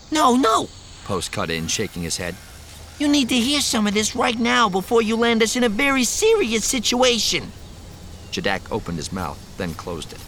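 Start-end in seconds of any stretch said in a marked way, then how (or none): 0.1-0.7 put-on high, loud nervous young man. Post voiced, insisting. 3.0-7.5 put-on high, loud nervous young man. Post voiced, urgent.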